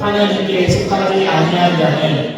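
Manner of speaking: slow, chanted congregational reading of the verse; unison scripture reading. Acted. Congregation recites scripture with the pastor, slow chant. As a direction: read it together in a slow sing-song chant.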